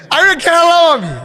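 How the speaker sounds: high, loud defiant cry; shouted insistence; insistent shout. Acted. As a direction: shout it, daring them to doubt. Character insists he went.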